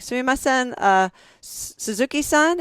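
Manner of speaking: high-pitched, tentative question; polite approach. Retold or imitated. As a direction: imitate hesitantly addressing a colleague. Retold polite approach to a colleague.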